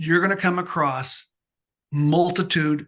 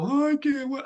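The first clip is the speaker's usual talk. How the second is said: high whine of a son giving up; mock a kid who wants to quit; lightly imitated whining son; whiny son; 9 semitones higher than usual.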